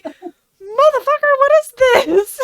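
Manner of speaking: high, loud mock outrage; outraged disbelief. Frank looks over at the sandwich, outraged disbelief. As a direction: exclaim it in hammed-up indignant shock.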